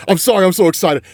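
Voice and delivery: real excitement, voice lifted; excited apology. Real, not acted. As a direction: let the excitement burst through the apology.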